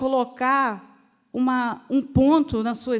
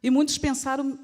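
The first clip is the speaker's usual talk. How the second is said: ordinary talk, nothing marked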